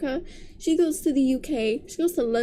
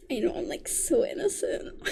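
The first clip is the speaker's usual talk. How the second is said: soft, coy whisper, mocking the heroine; mock innocent whisper; 5 dB quieter than usual. Reviewer whispering the heroine's coy line in mockery. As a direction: breathe it like a fake innocent.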